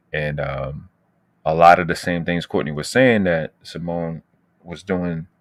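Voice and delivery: usual speaking voice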